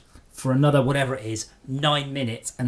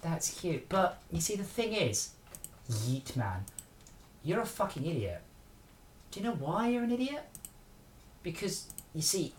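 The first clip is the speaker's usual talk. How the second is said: much softer, mock-sweet patronising voice; condescending; 3 semitones higher, 12 dB quieter than usual. Streamer belittling troll in chat, much softer and patronising. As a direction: talk down softly like to a toddler.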